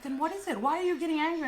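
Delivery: high-pitched, insistent mock-mum questions; retold exasperated questioning. Mum questioning her angry daughter, high and exasperated. Mum's retort in retold quarrel.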